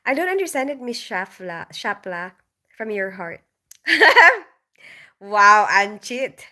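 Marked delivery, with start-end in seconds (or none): 3.7-4.4 loud laugh. Real, not acted. Bursts out at a chat comment.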